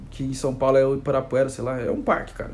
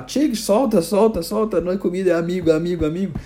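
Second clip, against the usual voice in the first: urgent, raised voice, rapid repeats; tiger owner commanding; 3 semitones higher, 3 dB louder than usual. Acted. Owner telling the tiger to release a child, acted urgency. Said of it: "Aí eu vou falar".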